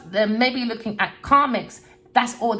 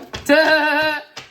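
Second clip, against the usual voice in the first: loud, high real laugh; burst of laughter; 7 semitones higher, 6 dB louder than usual. Real, not acted. The reader laughing at her own jab at the boy, loud and high. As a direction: crack up at your own jibe.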